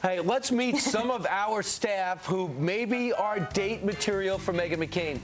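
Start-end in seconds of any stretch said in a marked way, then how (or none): none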